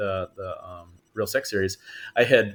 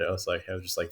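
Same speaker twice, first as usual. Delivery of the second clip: normal talking voice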